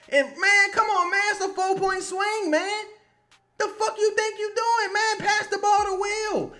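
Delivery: high-pitched, incredulous fan rant; real exasperated scolding. Real, not acted. Fan scolding player to pass the ball, exasperated. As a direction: plead angrily with the player, pitch way up.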